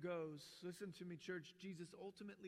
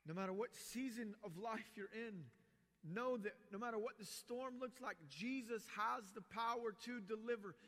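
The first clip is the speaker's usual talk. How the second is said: louder, higher preacher's drive; fervent preaching; 5 semitones higher, 4 dB louder than usual. Real, not acted. Pastor urging the church to trust Jesus, rising fervent preaching. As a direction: press the point home to the congregation.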